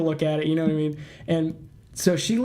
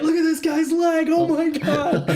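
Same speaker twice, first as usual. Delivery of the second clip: high laughing mock amazement; 11 semitones higher, 4 dB louder than usual. Friends laughing at old files, giddy high squeal.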